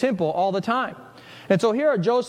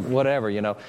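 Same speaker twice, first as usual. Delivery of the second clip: careless parent; 6 semitones lower than usual. Retold or imitated. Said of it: "it's like".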